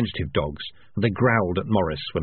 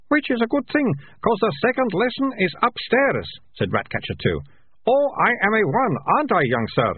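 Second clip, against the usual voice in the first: rat catcher; 13 semitones higher than usual. A narrator's reading.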